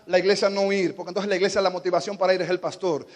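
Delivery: usual speaking voice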